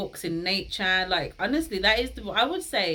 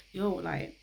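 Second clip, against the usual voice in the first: ordinary talk, nothing marked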